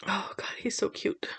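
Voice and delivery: soft whispered aside; whispering. Real, not acted. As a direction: whisper it fondly. Doting whisper over baby photo.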